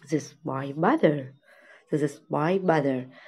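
This is my father, very slow and clear; slow model reading. Shown as a demonstration. Teacher reads the girl's line to kids, very slow. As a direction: spell it out slowly for the kids. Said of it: "teacher modeling the dialogue".

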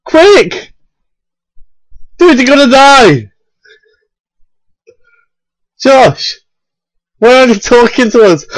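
high, frantic yelling; panicked shouting. Real, not acted. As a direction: yell at him in panic. Panicked urging teammate.

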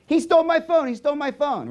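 hammed-up, high-pitched sibling accusation; very high mock shriek; mock screaming. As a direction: imitate a sister yelling to tattle. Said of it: "Screams, yells".

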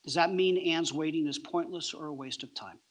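usual speaking voice

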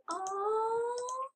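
mock sympathetic aw; imitating viewers' sympathy. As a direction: coo like a sympathetic fan. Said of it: "You guys are like".

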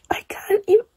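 breathless overwhelmed whisper; stunned whisper, high in pitch. Real, not acted. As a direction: barely get the words out, whispering. Overwhelmed by the book's end.

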